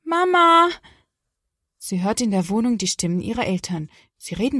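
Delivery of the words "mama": loud, high, drawn-out call for mum; immer lauter rufend. A narrator's reading. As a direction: call out urgently to be heard. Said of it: "dann immer lauter ruft sie". (laut und hoch rufen)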